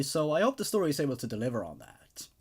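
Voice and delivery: ordinary delivery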